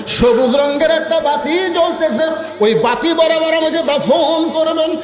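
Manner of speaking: high, sustained chanted lament; preaching lament. Acted. Fatema telling of her father's death, high chanted lament. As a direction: raise it into a mournful chant.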